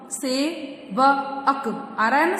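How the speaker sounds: सेवक drawn out very slowly, syllable by syllable; teacher slowing सेवक for the class; slow spelling out. Shown as a demonstration. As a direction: stretch सेवक to show its ending. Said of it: "जैसे कि आप देख सकते हैं".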